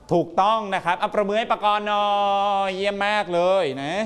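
rally the class to applaud; encouraging praise. Real, not acted. Teacher leads applause for correct pupil.

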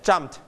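"jumped" in one syllable with a light final t; softened t ending. Shown as a demonstration. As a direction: tuck the t of "jumped" in, no extra vowel. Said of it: "มีเธอเบาๆ".

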